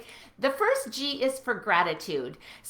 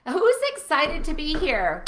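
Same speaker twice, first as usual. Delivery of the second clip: ordinary talk, nothing marked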